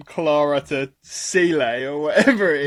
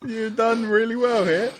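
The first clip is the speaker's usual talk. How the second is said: ordinary talk, nothing marked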